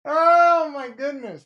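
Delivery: high, slow, loud oh-my-goodness; drawn-out shock. Real, not acted. Streamer reacts to a viewer's insult, drawn-out disbelief. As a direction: let the disbelief stretch out. Reacting to a rude chat comment.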